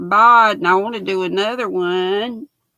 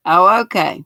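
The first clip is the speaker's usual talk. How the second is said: ordinary talk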